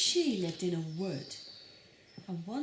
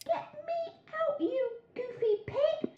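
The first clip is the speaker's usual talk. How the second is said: much higher muffled grandma call; grandma shouting out of the wolf's tummy. Acted. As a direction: yell from inside the belly. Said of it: "shouted Grandma".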